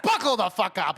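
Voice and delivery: loud, punchy quoted catchphrase; quoting a yell. Retold or imitated. Hyping up co-host's sign-off, loud quote. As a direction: belt the line like a hype call. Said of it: "he's like".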